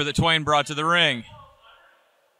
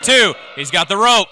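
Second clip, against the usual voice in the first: excited ringside play-by-play; wrestling commentator; 6 semitones higher, 3 dB louder than usual. Acted. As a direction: call the near fall live. Live play-by-play of the match.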